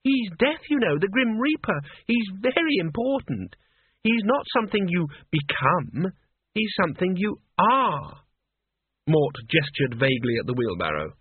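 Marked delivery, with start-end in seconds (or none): 0.0-8.2 put-on high, indignant girl lecturing; acted scolding, pitched well up; snappish. A narrator's reading. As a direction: set him straight in a high, haughty voice. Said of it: "she snapped".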